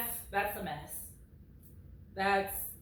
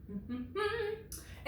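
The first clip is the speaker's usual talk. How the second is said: laugh; 11 semitones higher, 7 dB quieter than usual. Real, not acted. Reacting to the story update.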